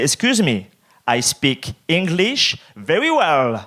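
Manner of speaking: drawn-out, over-careful English; slow, laboured English with heavy French accent; slow deliberate English. Acted. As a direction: spell it out slowly to cut someone off. Cutting off officer in English.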